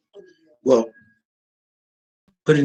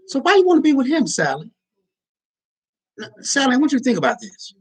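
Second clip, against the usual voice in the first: pleading with Sally in a raised voice; high mock advice voice; 9 semitones higher than usual. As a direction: coax her, pitched up. Voicing advice to a girl Sally.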